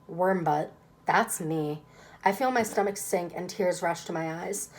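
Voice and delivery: ordinary delivery, nothing marked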